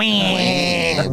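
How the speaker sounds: high mock crybaby wail; mock baby crying. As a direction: whine it like a teasing crybaby. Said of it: "call the Wambulance".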